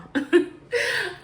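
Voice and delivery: a high burst of real laughter; sudden laugh. Real, not acted. Reader laughing at her own odd reading, high burst of laughter. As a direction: break into a high, surprised laugh.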